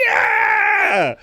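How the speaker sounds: very high shouted cheer; long screaming hurrah; imitated crowd roar. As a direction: scream it like a thrilled arena. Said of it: "everyone's like".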